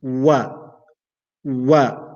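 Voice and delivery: wa started with round lips, then opened; Arabic waw. Shown as a demonstration. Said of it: "while my lips are round".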